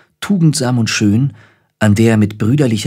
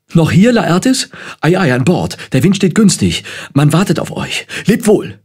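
high-pitched, agitated calling out; excited; 8 semitones higher than usual. A narrator's reading. Polonius rushes up to send Laertes aboard, excited. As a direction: hurry him along in a raised, excited voice. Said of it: "kam ihr Vater Polonius herbeigehastet und rief aufgeregt".

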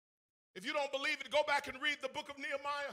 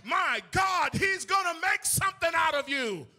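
louder, fervent sermon climax; rising, ringing preacher's call; preaching at full force; 6 dB louder than usual. Acted. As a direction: drive it home to the congregation.

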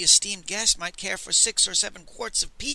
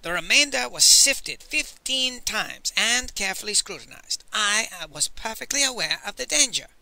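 emphatic, higher self-justification; indignant insistence; huffy; 6 semitones higher, 3 dB louder than usual. A narrator's reading. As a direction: press each point, mildly affronted. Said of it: "Master Li said huffily".

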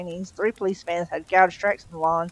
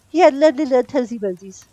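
high, louder doting coo; baby-talk cooing; 6 semitones higher, 7 dB louder than usual. Acted. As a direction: coo over a baby, pitched up. Entranced woman coos over baby's toes.

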